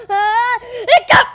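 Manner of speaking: sharp high loud hiccup burst; sudden loud hic. Pretend. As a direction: blurt the hiccup out hard. Big hiccup acted out.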